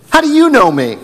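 sharp high-pitched how do you know me; high incredulous question. Acted. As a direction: ask it in disbelief, way up high. Preacher voicing Nathanael's question.

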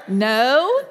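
loud laughing no. Retold or imitated. Stranger refusing to buy her lunch, loud laughing no.

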